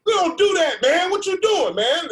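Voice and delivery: high, loud indignant scolding; hammed-up outrage from the drummer; angry bongo player.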